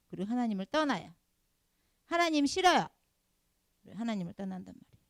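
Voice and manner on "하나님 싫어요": high, loud mock outburst; defiant rejection. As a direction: act out a loud, petulant refusal. Acting resentful believer quitting God.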